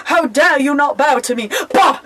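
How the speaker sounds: loud, high, laughing mock diva; haughty mock outrage. At the store, demanding a bow: haughty mock shout. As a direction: play an entitled snob shouting at a store clerk. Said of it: "I go to the store and be like".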